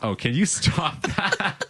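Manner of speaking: high, drawn-out protest through laughter; laughing plea. Real, not acted. Host pleads with joking co-host to stop, laughing. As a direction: beg them to stop while cracking up. Cracking up at co-host's joke.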